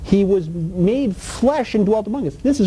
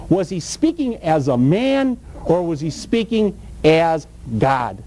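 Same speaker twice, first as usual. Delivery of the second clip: usual speaking voice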